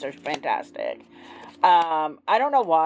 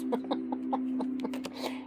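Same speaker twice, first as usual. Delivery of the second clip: quiet, breathy chuckle; real little laugh under the breath; soft giggle; 8 semitones higher, 9 dB quieter than usual. Real, not acted. Delighted reviewer gushing over cute animals, soft giggle.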